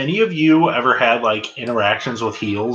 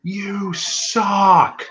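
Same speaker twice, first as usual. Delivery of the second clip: higher-pitched mock shout, no real volume; retold heckle; 8 semitones higher than usual. Fan retelling how they heckled a wrestler, mock shout. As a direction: call out a drawn-out heckle, held back. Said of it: "I just shout out".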